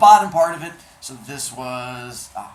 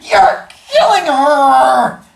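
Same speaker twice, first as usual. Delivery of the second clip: very loud, slow shrieking scream; 12 semitones higher, 17 dB louder, much slower than usual. Acted. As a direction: scream it out in panic. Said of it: "the Sicilian screamed".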